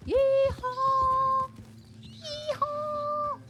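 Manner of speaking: long high hammed-up yeehaw call; cowboy yeehaw. Greeting the ranch, a high drawn-out yeehaw. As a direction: holler like a cartoon cowboy.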